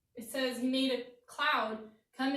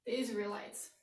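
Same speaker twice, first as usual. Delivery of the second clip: usual speaking voice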